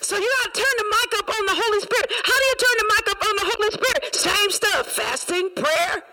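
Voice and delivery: high, driving pulpit cadence; sustained, high-pitched exhorting; fervent preaching. Real, not acted. Preaches at full force.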